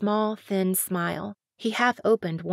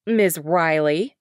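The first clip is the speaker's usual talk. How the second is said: slow, louder, lower formal address; cold greeting; 3 semitones lower, 6 dB louder, slower than usual. Acted. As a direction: stiff politician's greeting.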